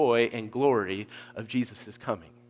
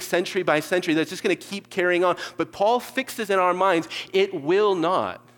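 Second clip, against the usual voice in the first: raised, insistent sermon voice, louder; preaching at full force; 5 semitones higher, 5 dB louder than usual. Acted. Preacher warning his congregation not to be deceived, fervent preaching. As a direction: press the warning home.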